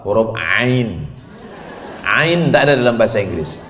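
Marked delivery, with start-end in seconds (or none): 0.0-1.1 slow throaty "ain"; slow demo of Arabic ain. Shown as a demonstration. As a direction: drag out the "ain". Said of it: "Huruf apa?".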